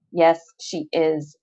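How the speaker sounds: cold, level calm; measured, even reply; even, slow. A narrator's reading. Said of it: "I say evenly".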